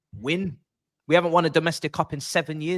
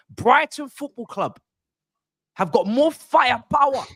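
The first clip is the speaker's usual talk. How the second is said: indignant emphasis; 7 semitones higher, 4 dB louder than usual. Real, not acted. Fan ranting about rivals.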